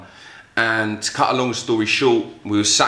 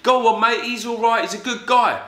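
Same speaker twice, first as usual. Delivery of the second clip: high-pitched mock encouragement; imitated egging-on; 10 semitones higher than usual. Mates pushing him to go with the stranger, egging on. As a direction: chip in high, urging him along.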